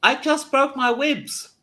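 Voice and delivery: strained, high-pitched cry of complaint; pained protest. Acted. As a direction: protest loudly through injured ribs. Injured character protesting during the game.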